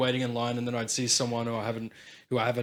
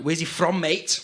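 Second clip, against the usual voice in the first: higher-pitched Liverpool impression; Liverpool accent; 4 semitones higher, slower than usual. Shown as a demonstration. Showing off accents to friends, higher Liverpool voice. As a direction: put on a bit of a Scouse accent. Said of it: "a bit of a Liverpool accent".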